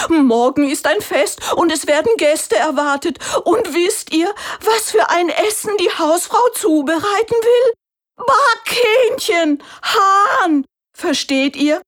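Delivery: very high, loud, wailing rooster voice; a terrified bird squawking about his fate; panicked rooster. A narrator's reading. Rooster telling the animals he'll be cooked, high panicked wail. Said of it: "antwortete der Hahn ganz aufgeregt".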